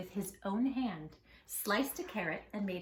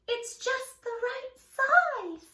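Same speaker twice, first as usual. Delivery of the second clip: high, slow squeaky delighted mouse; tiny mouse voice; 14 semitones higher, 6 dB louder, slower than usual. Acted. Missus Mouse delighted with the tiny tree, high and slow. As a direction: savor it like a pleased little mouse.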